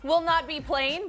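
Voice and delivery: ordinary talk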